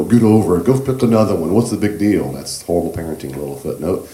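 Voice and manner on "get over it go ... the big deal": higher, louder scolding parent; dismissive parent. Retold or imitated. Teacher imitating a horrible parent brushing off a child, a dismissive scold. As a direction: snap it off like a careless parent. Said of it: "horrible parents go".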